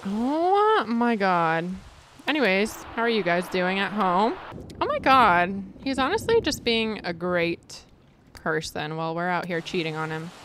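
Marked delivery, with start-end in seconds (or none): none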